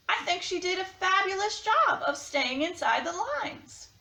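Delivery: a hint of a no-nonsense crayon scolding the kid; pink crayon letter voice. To Duncan, a neglected crayon asks to be used.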